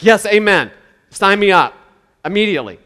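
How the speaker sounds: loud, eager cry of yes, amen; eager mock amen. Preacher imitating an eager convert in a poorer neighborhood, loud and keen. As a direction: play someone jumping at the offer. Imagined eager congregation response.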